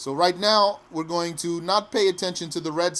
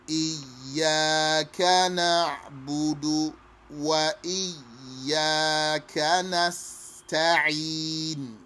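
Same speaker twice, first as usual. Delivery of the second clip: very slow drawn-out chanted verse; slow recitation; much slower than usual. Shown as a demonstration. Teacher chanting the verse slowly.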